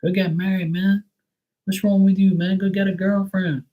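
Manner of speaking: high, nagging mock voice; high mock nagging from people twenty years ago; mocking pushy people. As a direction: hound him like a pushy relative. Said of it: "they were like".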